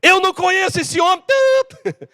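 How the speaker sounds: high shouted denial ending in bleep; acted cursing outburst; losing it, swearing. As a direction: snap and deny him furiously. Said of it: "ele saiu do sério".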